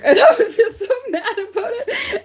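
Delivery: high, loud laughing through the words; giggling high and loud. Real, not acted. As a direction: laugh it off while telling. Laughing at her own anger.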